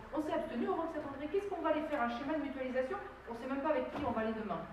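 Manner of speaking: high-pitched, indignant rhetorical questions; exasperated objection. Real, not acted. Exasperated objection to mutualisation scheme.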